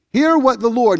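lifts the voice to read scripture; solemn public reading. Acted. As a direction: read it out like a proclamation. Preacher reading scripture aloud.

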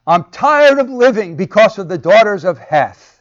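high, loud anguished complaint; emotional outburst. Retold or imitated. Rebecca bursting out about Esau's wives, loud and high. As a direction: burst out in dramatic despair. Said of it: "emotional outbursts".

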